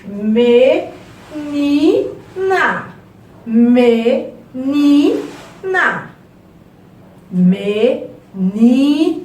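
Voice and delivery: ME... NI... NA, loud and broken into syllables; sílaba por sílaba. Shown as a demonstration. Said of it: "fala a palavra ma-, várias vezes". ("Menina" em voz alta, me... ni... na)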